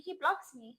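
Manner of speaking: high-pitched incredulous report; disbelief. Real, not acted. First telling of being blocked.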